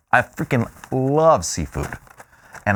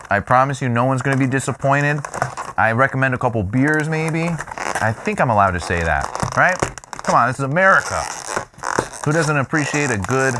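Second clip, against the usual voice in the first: ordinary talk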